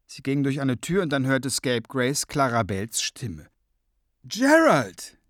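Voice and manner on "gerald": high, loud cry of greeting; ringing, raised-pitch exclamation; delighted call. A narrator's reading. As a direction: call out to an old friend. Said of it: "rief sie".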